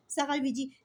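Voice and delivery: usual speaking voice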